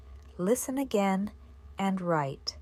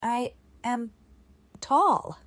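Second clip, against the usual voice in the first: dictating; 4 semitones higher, 4 dB louder than usual. Shown as a demonstration. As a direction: spell it out for a class writing it down. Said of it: "This dictation follows three steps".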